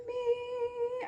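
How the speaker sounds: high, drawn-out little squeal; gleeful singsong. Acted. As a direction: hold it long and high, pleased with yourself. Gleefully answers own question, singsong.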